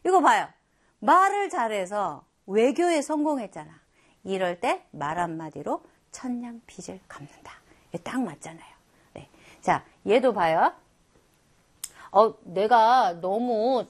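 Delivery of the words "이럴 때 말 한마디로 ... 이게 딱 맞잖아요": hushed whisper of the proverb; whispered, as if sharing a tip. Shown as a demonstration. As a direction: lean in and whisper it like a secret. Teacher whispers the proverb's point.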